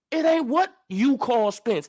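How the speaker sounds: ordinary delivery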